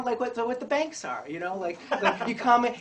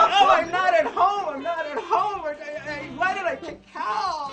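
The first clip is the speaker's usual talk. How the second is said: much higher, louder, slowed words through laughter; laughing panic; 8 semitones higher, 5 dB louder, slower than usual. Pretend. A lone cow among ducks, panicking through laughter. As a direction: squeal it out while cracking up.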